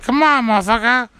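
high-pitched taunting dare; mock fight challenge. Friend squaring up to the waitress, mock challenge. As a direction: egg them on, playfully combative.

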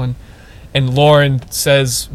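normal talking voice

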